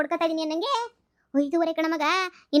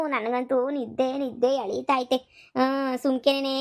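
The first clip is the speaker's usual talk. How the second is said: slow, drowsy half-awake voice; sleepy drawl; 3 semitones lower, slower than usual. Acted. Woken daughter-in-law answers grandma, slow sleepy voice. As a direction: drag the words out half asleep.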